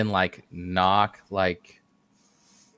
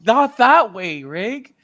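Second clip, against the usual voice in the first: exasperated; 13 semitones higher, 6 dB louder than usual. Real, not acted. Exasperated at a fellow player.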